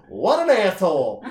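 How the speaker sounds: high, loud, drawn-out mock outrage; offended bar girl. Girl insulted by a stranger at the bar, mock indignant voice. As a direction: imitate her affront, stretching the words. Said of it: "she looked and she goes".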